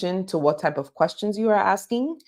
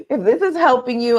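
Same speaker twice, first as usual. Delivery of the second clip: laughing; 6 semitones higher, 4 dB louder than usual. Real, not acted. Laughing while teaching.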